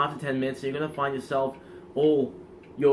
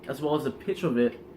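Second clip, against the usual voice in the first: usual speaking voice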